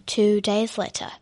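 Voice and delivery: ordinary delivery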